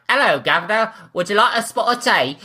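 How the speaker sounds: hammed-up Cockney offering tea; cheeky Cockney accent, played for laughs; mock Cockney. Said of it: "imagine walking up to King Charles".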